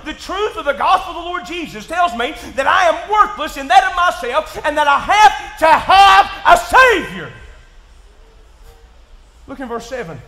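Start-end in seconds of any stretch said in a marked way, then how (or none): 0.0-7.3 shouted, climbing sermon delivery; full-force preaching. Acted. Pastor driving home his point to the congregation, shouting. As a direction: preach it out loud and hard.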